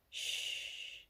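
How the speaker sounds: breathy hush, barely heard; hushing whisper. A narrator's reading. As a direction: soothe him to silence in a whisper. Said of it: "so quietly I could barely hear her".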